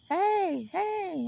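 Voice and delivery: high, loud mock call of hey; teasing hail from afar. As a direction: call out across the field. Unseen kids calling from field.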